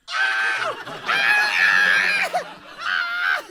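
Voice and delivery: very high, loud laughing shrieks; excited screaming laugh. Retold or imitated. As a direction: shriek with delight. Reacting to Dory answer.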